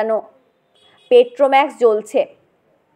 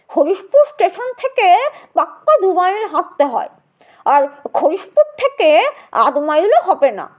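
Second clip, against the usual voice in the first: put-on old gentleman; 5 semitones higher than usual. Old gentleman explains the route.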